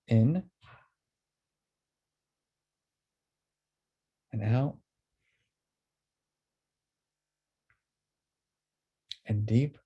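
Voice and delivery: unhurried meditation guide, leaving room to breathe; guided breathing exercise. Acted. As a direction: lead a group through breaths, drawing out each word.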